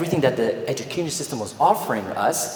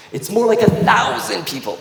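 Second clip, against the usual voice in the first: ordinary delivery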